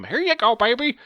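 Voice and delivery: very high, loud cooing at the dog; mock baby talk to dog. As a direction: coo way up high like to a pet. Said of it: "she would grab ... treats".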